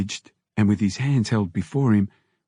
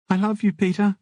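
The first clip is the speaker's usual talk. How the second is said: higher, tender woman's voice; saying goodbye with love; loving Mimi; 10 semitones higher than usual. A narrator's reading. Wife ending the prison call, higher tender voice.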